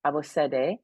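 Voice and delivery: ordinary delivery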